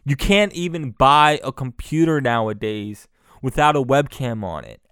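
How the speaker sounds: usual speaking voice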